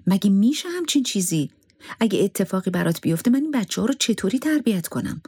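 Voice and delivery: upset. A narrator's reading. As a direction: ask it in worried disbelief, voice lifted. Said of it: "ناراحت شد و گفت".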